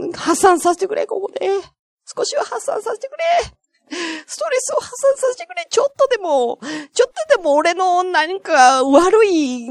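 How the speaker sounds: comic high-pitched desperation; mock pleading. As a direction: beg to let it all out. Begs to be allowed to vent.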